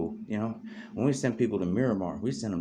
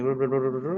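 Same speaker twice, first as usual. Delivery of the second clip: rapid babbled gibberish, raised and louder; mock speaking in tongues; imitated fast talk; 5 semitones higher, 6 dB louder than usual. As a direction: rattle off nonsense syllables like a fast foreign talker. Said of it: "you talk like fast".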